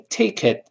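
"take it" run together as "tay-kit"; "take it" as one word, final t held; linked "take it". Shown as a demonstration. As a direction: link the k of "take it" into the vowel. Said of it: "连到一块儿".

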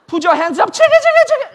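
high, loud, put-on English command; hammed-up stick-up cry; mock police shout. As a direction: bark the order in a put-on voice. Acted robber holdup scene.

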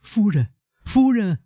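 high, urgent cry up the stairs; panicked high-pitched summons; loud calling. A narrator's reading. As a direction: call the lady of the house in alarm. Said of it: "大声叫她的继母".

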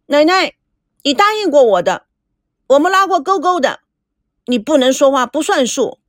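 put-on high-pitched childish pleading; tearful, stubborn demand. A narrator's reading. Girl holding grandma to a pinky promise, petulant. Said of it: "赵美娇却耍起横来， 眼睛里面含着眼泪".

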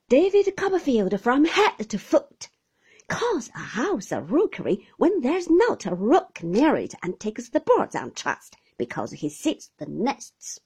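mock the man's foolishness; scornful old aunt. Aunt Betty sneers at the husband's rookery, scornful.

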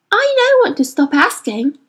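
a touch of wounded pride; Lyra, defensive. A narrator's reading. Girl defending herself to the boy, defensive. Lyra's voice.

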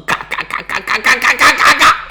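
stuttering creak that climbs in pitch; rapid repeated creaks, rising as the gate opens; imitated gate creak. Storyteller mimics Corioli's gates opening, rising creaks.

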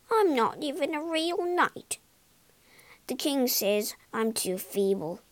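ordinary delivery

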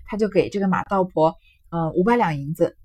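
ordinary delivery

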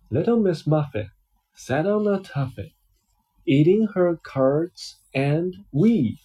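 lively picture-book reading voice; reading to a child. Acted. Dad reading a picture book to his child, storytelling voice. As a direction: read it out like a bedtime story for a little one.

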